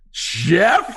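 high, loud disbelieving cry; retold shocked double take; mock astonishment. Retold shock at recognizing friend.

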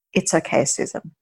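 dry, understated mock reassurance; dry, naughty. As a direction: say it drily, teasing with false comfort. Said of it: "his very dry, funny, you know, nerdy doctor".

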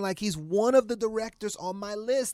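high-pitched, eager fan rush; retold excitement. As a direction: relive the thrill of meeting an idol. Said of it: "I'm, I'm excited".

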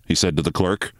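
usual speaking voice

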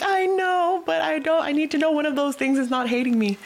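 high-pitched mock pleading; acting out an anxious, insistent friend; needy protest. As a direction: plead for reassurance, pitched well up. Retold anxious plea to busy friend.

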